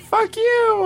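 mock screech way up in falsetto; hammed-up high-pitched outburst; high-pitched screaming. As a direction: imitate a screaming neighbor, very high. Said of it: "screaming this in a high-pitched".

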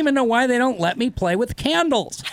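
put-on high, far-off girlish voice, half-joking; imitating a naive young girl; mock little girl. Friend mocks Britney's innocent complaint, in a high girlish voice. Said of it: "She's like".

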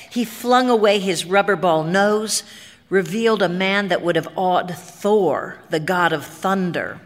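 ordinary delivery, nothing marked

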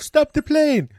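high frantic mock plea; hammed-up panicked mother; sitcom panic. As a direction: shriek it like a sitcom mum. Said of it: "a real sitcom thing of".

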